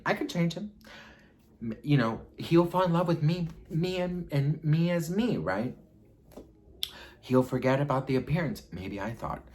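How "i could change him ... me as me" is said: hopeful. Retold or imitated. Hopeful fantasy, high.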